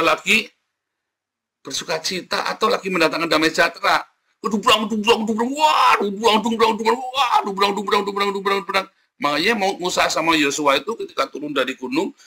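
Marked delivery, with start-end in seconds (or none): 4.4-8.9 imitated drum clatter. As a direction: mimic clashing drums with your voice. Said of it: "serem, saudara".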